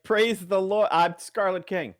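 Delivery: high, drawn-out pious exclamation; hammed-up religious praise; mock praise. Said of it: "it's like".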